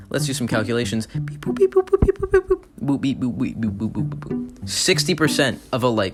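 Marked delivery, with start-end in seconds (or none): 1.1-4.7 rapid-fire beep boop noises, fading away; mock calculator beeps. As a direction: imitate a computer crunching numbers. Imitating a calculator working out sums.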